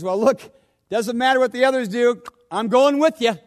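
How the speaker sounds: loud, high, insistent promise; Peter's bold vow. Acted. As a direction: declare it boldly, chest out. Peter boldly insists to Jesus.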